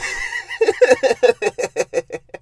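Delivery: high, loud incredulous laugh; high burst of laughter. Real, not acted. Reviewer cracking up over the date scene, high laugh. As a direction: crack up at how bad it is.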